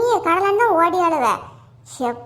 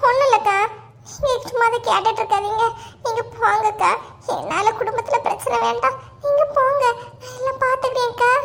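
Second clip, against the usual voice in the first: high, weepy self-sacrificing plea; fake tearful pleading; 6 semitones higher than usual. Gita tells the neighbour to leave it, fake tearful pleading. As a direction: plead through put-on tears. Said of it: "இது உலக மகா நடிப்புடா சாமி".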